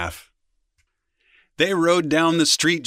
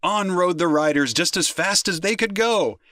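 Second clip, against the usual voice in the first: ordinary delivery, nothing marked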